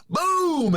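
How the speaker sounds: a high, sharp "boom" sound; imitated explosion. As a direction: mimic the blast with a burst. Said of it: "and then".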